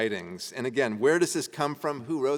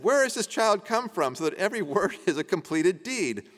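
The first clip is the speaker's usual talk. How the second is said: laughing; 7 semitones higher, 3 dB louder than usual. Real, not acted. Reading the villagers' line, laughing.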